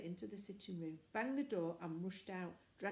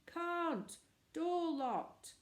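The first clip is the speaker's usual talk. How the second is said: high, louder call back; shouted reply; 9 semitones higher, 4 dB louder, much slower than usual. A narrator's reading. Boy calling down to the men, shouting. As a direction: shout it down from the tower. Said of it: "the same voice replied".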